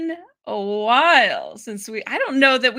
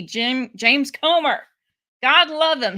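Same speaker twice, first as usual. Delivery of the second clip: ordinary delivery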